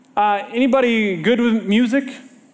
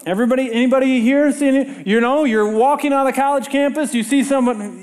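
usual speaking voice

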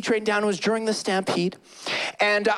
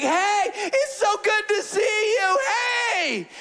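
overjoyed greeting; 12 semitones higher, slower than usual. Retold or imitated. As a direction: greet a long-lost friend. Retold excited greeting, shouting.

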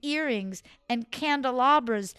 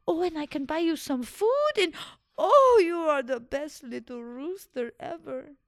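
higher, louder gasping delight; delighted old woman; 8 semitones higher, 5 dB louder than usual. Acted. Old woman thrilled at the diamond, gushing higher. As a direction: gush over the rooster with joy.

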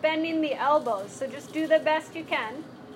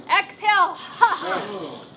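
sharp ha breath; 3 semitones higher, 6 dB louder, slower than usual. Shown as a demonstration. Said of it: "we're gonna go".

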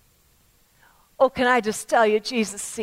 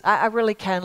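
ordinary talk

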